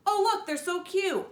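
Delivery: put-on high, cooing kid voice; excited child voice. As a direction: squeal over something cute. Child coos over gerbils.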